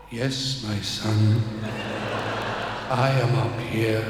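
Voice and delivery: low, slow, solemn divine voice; voice of God. Acted. God answering the man on the cliff, low and solemn. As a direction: speak down from heaven.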